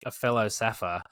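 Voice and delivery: ordinary talk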